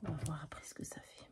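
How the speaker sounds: soft unvoiced whisper. Real, not acted. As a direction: murmur it to yourself without voice. Chuchote en travaillant. (chuchoté)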